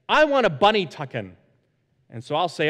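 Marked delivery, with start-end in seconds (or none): 0.1-1.4 louder, higher imitation of an eager kid; mock a child's keen bedtime request. Twins asking dad for a tuck-in, eager imitation. Voicing his excited young twins.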